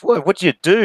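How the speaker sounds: high-pitched astonished question; acted amazement. Joke-teller voices amazed customer asking hooker, high astonished question. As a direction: ask it in mock wonder, pitched right up.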